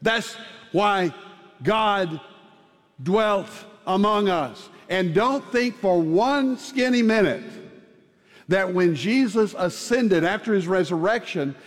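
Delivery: rising, fervent preacher's build; sermon climax over music; preaching. Acted. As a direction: lift the congregation with hope.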